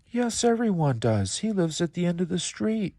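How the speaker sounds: put-on high, bright-eyed child's voice; a curious little boy asking kindly. Little boy asks the crying alligator, high and curious.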